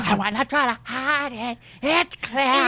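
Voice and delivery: high sung lisping croon; [name] impression. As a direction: sing it with a slushy lisp. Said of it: "I have to get my [name]".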